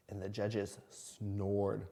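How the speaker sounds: slow, drowsy, soft; sleepy slow read. A narrator's reading. Reading snoring judges slowly.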